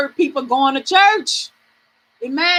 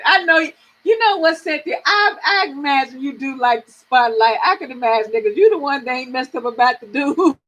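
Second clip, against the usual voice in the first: usual speaking voice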